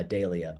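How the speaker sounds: "dahlia" with first syllable as DAY; speaker's own pronunciation. Shown as a demonstration. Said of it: "when I say".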